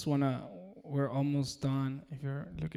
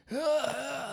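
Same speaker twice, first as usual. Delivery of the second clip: high-pitched strained "ah"; imitated strained singing; 11 semitones higher than usual. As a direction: push out a strained high note with a lost voice. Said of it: "pushing it so hard".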